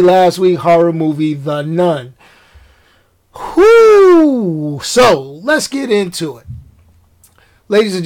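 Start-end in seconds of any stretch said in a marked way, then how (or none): 3.6-4.8 spooky whoo. Pretend. After naming a horror movie.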